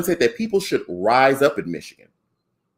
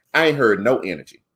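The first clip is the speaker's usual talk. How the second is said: ordinary talk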